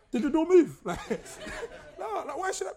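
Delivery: laughing through a retold scare, loud and high; laughing mock fright. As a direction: giggle while acting spooked at the door. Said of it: "I'm like".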